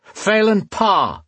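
high, drawn-out challenging yell. A narrator's reading. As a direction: call out a name across the night. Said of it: "Alesh yelled, his voice echoing in the night".

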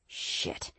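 very soft, voiceless hiss; sharp whispered swear. A narrator's reading. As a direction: hiss the curse under your breath. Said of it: "Aaron hissed".

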